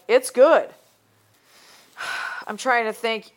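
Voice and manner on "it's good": a hint of a star's blurb, up and pleased; lightly voiced famous endorser; mock celebrity endorsement. Imagining a star plugging a film, mock promo. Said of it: "someone would be out there like".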